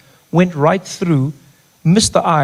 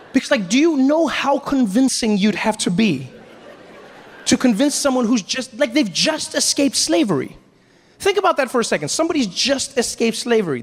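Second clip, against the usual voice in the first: high-pitched comic disbelief; astonished, pressing the point on the audience; incredulous; 9 semitones higher than usual. Real, not acted. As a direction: marvel at it, voice pitched way up.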